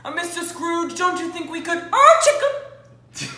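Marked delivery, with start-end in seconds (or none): 1.9-3.3 high, loud, slow startled squeal; startled cry. Acted. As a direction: yelp in sudden fright. Startled by Scrooge.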